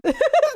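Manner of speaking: squeaky high laugh; sudden high giggle; high burst of laughter. Real, not acted. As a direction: laugh high and short in disbelief. Laughs at chainsaw scene.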